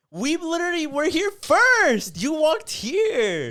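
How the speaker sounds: high, loud, drawn-out indignant imitation; mock outraged shouting. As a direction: protest at the top of the voice, hammed-up. Imitated lady protests in the quarrel.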